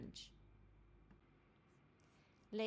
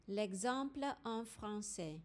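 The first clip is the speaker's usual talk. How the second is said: normal talking voice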